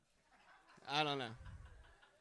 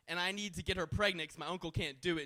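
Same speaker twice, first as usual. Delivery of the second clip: ordinary talk, nothing marked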